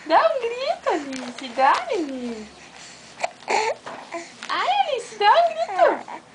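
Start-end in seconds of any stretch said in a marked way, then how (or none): 0.1-0.9 high, eager coaxing; high coaxing call. Real, not acted. Aunt coaxing baby to shout, high and eager. 4.5-6.2 high, eager coaxing; high coaxing call. Real, not acted. Aunt coaxing baby to shout, high and eager.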